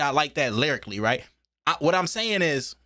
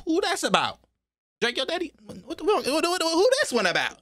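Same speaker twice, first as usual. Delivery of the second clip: high, baffled questions; shocked confusion; 12 semitones higher than usual. Real, not acted. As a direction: ask in stunned disbelief, voice jumping up. Confused by the [name] line.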